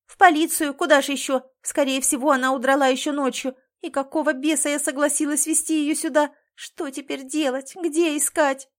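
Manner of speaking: loud, high, rushed with panic; words tumbling out in a panic; panicked. A narrator's reading. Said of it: "нотки паники".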